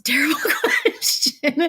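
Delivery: laughing through the words; nervous real laugh on the words. Real, not acted. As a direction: let a real laugh break into terrible question. Laughs at her own nervousness.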